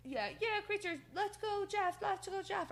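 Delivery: high-pitched mock fan chant; hammed-up crowd rooting; imitating a cheering fan. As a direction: cheer on a wrestler in a high put-on voice. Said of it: "one of the fans who's like".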